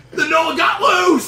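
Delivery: high, drawn-out hammed-up scream; shouted alarm. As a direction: scream the warning to the whole ship. Crewman shouts alarm about escaped gnoll.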